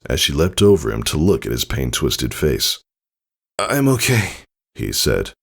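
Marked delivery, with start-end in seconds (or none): 3.6-4.3 strained reassurance. Acted. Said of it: "with heavy, trembling breath".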